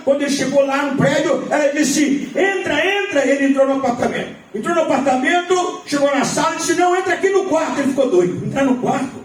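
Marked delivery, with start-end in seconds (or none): 2.3-3.3 urging woman. Retold or imitated. Woman urges man inside. 6.7-7.8 higher, quick insistent voice; urging woman. Retold or imitated. Woman ushers the man into the bedroom, insistent and quick.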